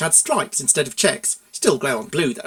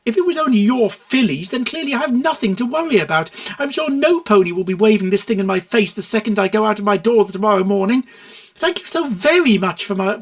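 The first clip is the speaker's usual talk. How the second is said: loud, higher, biting mock thanks; sarcastic tirade; 5 semitones higher, 5 dB louder than usual. A narrator's reading. Mare mocking the consultant's excuse, loud biting sarcasm. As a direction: pile on the sarcasm.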